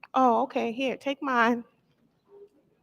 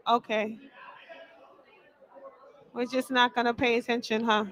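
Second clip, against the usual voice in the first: ordinary talk, nothing marked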